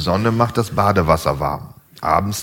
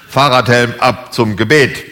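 loud, higher-pitched order; barked command; 6 semitones higher, 7 dB louder than usual. Acted. Reader orders everyone to prayer, barked command. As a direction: call it out like a drill command. Parody military command closing the reading.

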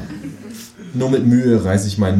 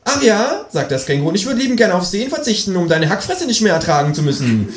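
high, huffy kangaroo retort; high kangaroo snapping back; offended kangaroo; 12 semitones higher than usual. Acted. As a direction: fire back with an insult. Kangaroo insulted.

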